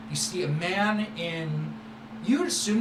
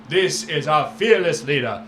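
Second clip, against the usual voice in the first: louder, sweeping showman voice; grand merchant boast; 4 dB louder than usual. Acted. Merchant Syrah boasting to the young friend, loud and showy. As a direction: boast like a flamboyant trader.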